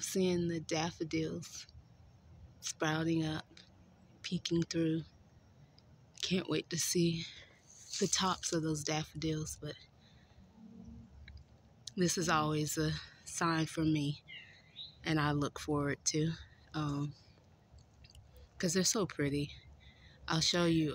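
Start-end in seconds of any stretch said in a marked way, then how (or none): none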